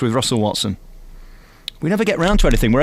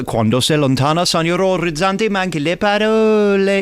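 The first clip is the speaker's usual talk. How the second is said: mock-operatic Italian with an English speaker's vowels; amateur Italian; 3 semitones higher than usual. Shown as a demonstration. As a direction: have a bold go at Italian, rising higher. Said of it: "I can do a bit of Italian".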